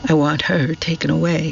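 plain reading voice

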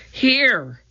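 high answering call, moderate volume. A narrator's reading. Annie answering her searching brother, calling out. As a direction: call back with pitch way up. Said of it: "she called".

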